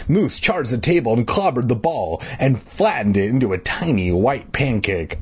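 ordinary delivery, nothing marked

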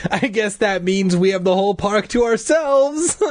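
high, strained joking tone; forced joke. Acted. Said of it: "forcing a smile".

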